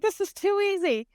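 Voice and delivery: high, gleeful mock of the scammer gloating; a hammed-up smug con man. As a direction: imitate him chuckling at how easy it is. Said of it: "He's probably like".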